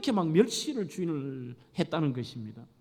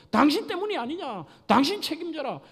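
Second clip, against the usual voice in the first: higher, accusing demand; accusing wife; 8 semitones higher than usual. Acted. A hint of a wife accusing her husband. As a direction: pin the blame on him. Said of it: "따져".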